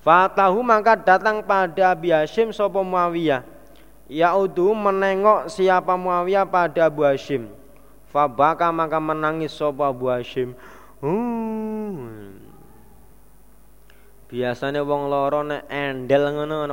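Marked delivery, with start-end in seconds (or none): none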